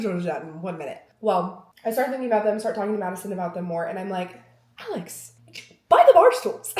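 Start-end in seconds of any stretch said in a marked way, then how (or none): 4.8-6.7 sudden loud high command; mock yell at herself. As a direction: bark the order at yourself, half-joking. Orders herself to buy.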